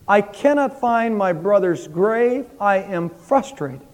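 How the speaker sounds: a hint of an anguished plea; mock cry to God. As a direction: voice a desperate man calling out to God, lightly. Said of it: "He begins to cry out".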